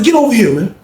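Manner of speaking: quoted shout to get over here; calling out. Retold or imitated. Michael calling Charles over.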